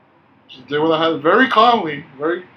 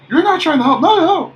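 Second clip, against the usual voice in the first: much higher and louder mock of an irate lady; mimicking angry guest; 13 semitones higher, 9 dB louder than usual. Clerk imitates a furious hotel guest scolding him, mock shrill. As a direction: screech her protest back in a hammed-up imitation.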